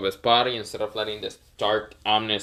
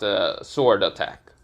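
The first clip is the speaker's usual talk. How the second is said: plain reading voice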